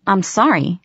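plain reading voice

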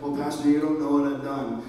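low, different-voiced objection; doubting congregant. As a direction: play a guilty listener pushing back. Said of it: "you're like".

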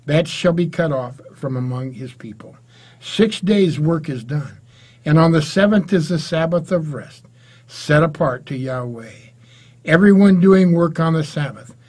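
ordinary talk

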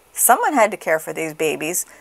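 plain reading voice

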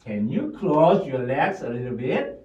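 slow, in two chunks; slow full sentence. Shown as a demonstration. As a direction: model the full sentence slowly. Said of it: "다시요". (두 묶음으로 천천히)

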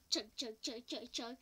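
rhythmic chug-chug train noise; imitated steam train; train chugging. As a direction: chug along like a little train. Imitating a train between stops.

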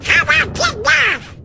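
high-pitched eager shout; excited outburst. Acted. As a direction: burst out eagerly. Excited friend calls out.

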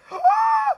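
sharp high gasp of surprise; shocked gasp. Real, not acted. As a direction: gasp as if the card stunned you. Shock at a card pull.

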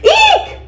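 high-pitched squeal; short, very high little scream. A narrator's reading. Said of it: "I screamed".

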